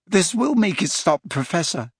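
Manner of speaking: high, strained reassurance; voice cracking. A narrator's reading. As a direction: promise relief with a catch in the voice. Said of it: "his voice cracking".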